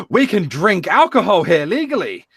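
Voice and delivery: gasp, then high-pitched mock amazement; thrilled Americans. Americans realise they're in England, mock excitement. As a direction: imitate giddy excited tourists.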